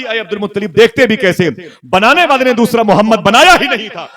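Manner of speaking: high, loud, joyful with a laugh; impassioned answer. Acted. Heavenly voice answering the grandfather, loud and laughing. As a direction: deliver the climax with a laughing cry.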